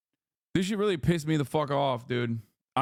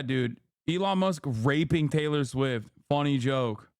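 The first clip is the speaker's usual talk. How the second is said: ordinary talk, nothing marked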